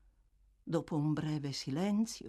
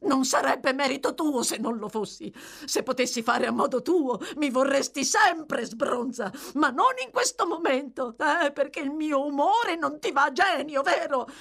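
bitter tirade; 9 semitones higher, 5 dB louder than usual. A narrator's reading.

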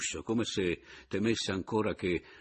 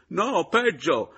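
high, loud, drawn-out cry; anguished loud exclamation; agitated outburst; 11 semitones higher, 10 dB louder, much slower than usual. Acted. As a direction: blurt out the worse news. Berto breaks terrible news.

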